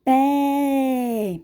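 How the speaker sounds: PÉ with a long, wide-open é; open vowel demo. Shown as a demonstration. Teacher gives pupils another acute-accent example, long open PÉ. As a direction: hold the é of pé open and loud. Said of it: "eu abro mais a boca".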